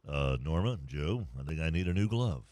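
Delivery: low, lightly played kid asking parents; a hint of a boy with a man's deep voice; low mock-child plea for a new glove; deep-voiced ten-year-old. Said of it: "have a voice this deep as a ten-year-old".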